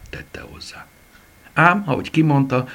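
ordinary delivery, nothing marked

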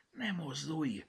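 ordinary delivery, nothing marked

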